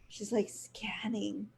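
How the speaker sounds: slow whisper; hushed, slow, as if not to disturb. Real, not acted. Describes cat staring at night.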